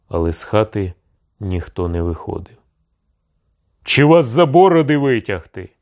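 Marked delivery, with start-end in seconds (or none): 3.8-5.7 loud, high threatening bark. A narrator's reading. Cossack chief threatens those hiding inside, barking. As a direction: bark a rough challenge at them. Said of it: "гарикнув загалом сумирний курінний".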